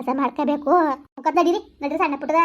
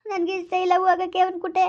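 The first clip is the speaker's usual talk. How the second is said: high tearful protest; crying; 4 semitones higher than usual. Acted. Betrayed young wife refuses to live with her husband, crying. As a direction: plead through sobs. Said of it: "ಯಾಕತ್ತಿಯವ?".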